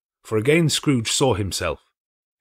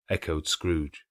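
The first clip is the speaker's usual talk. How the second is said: usual speaking voice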